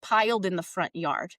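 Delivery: usual speaking voice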